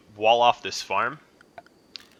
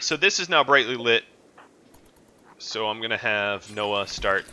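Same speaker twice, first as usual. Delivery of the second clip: usual speaking voice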